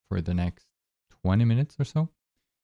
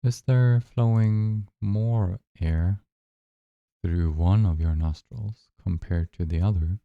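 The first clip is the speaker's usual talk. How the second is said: unhurried, even-toned guidance, leaving space between phrases; guided meditation. Acted. Guide asking listeners to notice their breath, calm guided meditation.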